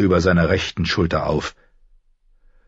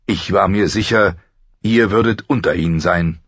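ordinary delivery

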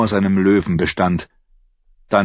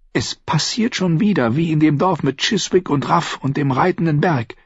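higher, dismayed thought; inner thought; 7 semitones higher than usual. A narrator's reading. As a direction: think it with rising dread. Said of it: "dachte Arya".

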